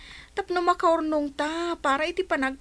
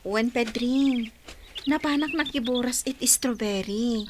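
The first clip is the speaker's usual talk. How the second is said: whisper; 6 semitones lower, 5 dB quieter than usual. Acted. Hushed reply to Pedring.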